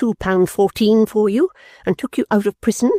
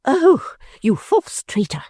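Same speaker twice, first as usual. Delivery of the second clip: high, furious woman's outburst; angry cry; 11 semitones higher, slower than usual. Acted. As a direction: shriek it at the faithless husband. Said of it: "cried the lady".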